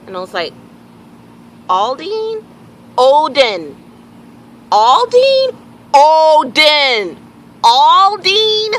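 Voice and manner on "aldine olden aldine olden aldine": louder and higher with each exasperated Aldine; escalating exasperated repeating; rising frustration in a name mix-up; frustrated back and forth. Retold or imitated. Arguing name with strangers.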